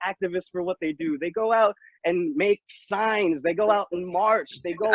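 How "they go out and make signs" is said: ordinary talk, nothing marked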